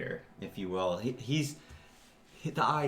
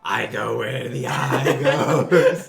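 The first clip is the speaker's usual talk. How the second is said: laughing ogre line; 8 dB louder, much slower than usual. Acted. Ogre character, laughing mid-line.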